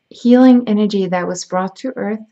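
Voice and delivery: ordinary talk, nothing marked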